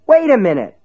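high, loud, urgent shout. A narrator's reading. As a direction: cry out to stop him. Ace shouting at the closing dealer.

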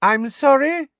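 very high, loud affected query; Umbridge, loud. A narrator's reading. Inspector pretending not to hear the teacher, loud. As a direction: pipe it loudly as if hard of hearing. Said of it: "said Professor Umbridge loudly".

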